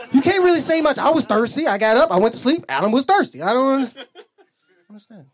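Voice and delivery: loud, high mock defensiveness; exasperated, hammed-up self-defense; indignant protest. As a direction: plead your case loudly, point by point. Acts out protesting his innocence.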